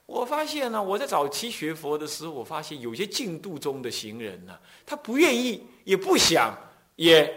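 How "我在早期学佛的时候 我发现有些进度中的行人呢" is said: normal talking voice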